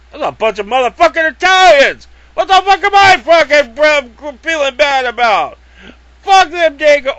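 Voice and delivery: imitating a shouting bigot, loud and high; mock shouted rant. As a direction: rant like a fake angry bigot.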